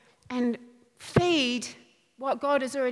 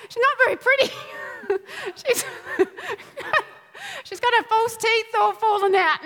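laughing through words; 9 semitones higher than usual. Real, not acted. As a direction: giggle through the joke. Laughing at her own hag image.

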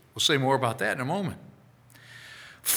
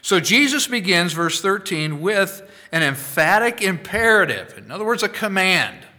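ordinary talk, nothing marked

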